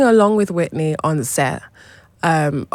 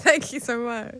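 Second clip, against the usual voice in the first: laughing thanks; 8 semitones higher than usual. Real, not acted. Laughs at praise.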